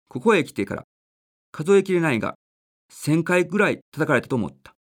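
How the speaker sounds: gentle storybook reading voice; measured, earnest reading of a child's essay; story narration. A narrator's reading. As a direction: read it aloud like a radio story. Reads a child's story aloud.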